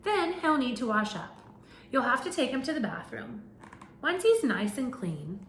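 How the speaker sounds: animated picture-book narration; reading to children. Acted. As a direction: read it out to a class of little kids. Reads picture book to class.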